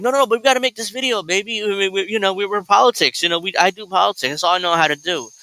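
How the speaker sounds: pleading husband voice. Retold or imitated. Kyle begging his wife to film, high fast wheedling. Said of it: "Kyle's like".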